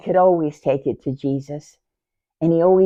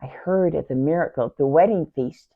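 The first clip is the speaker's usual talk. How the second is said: ordinary delivery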